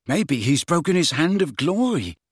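vaguely suggesting, only half attending. A narrator's reading. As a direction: offer a vague guess in a lifted voice. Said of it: "said Ron vaguely".

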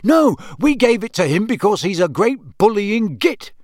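a prankster son justifying himself; cheeky twin. Acted. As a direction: fling the insult with relish. George backs his twin.

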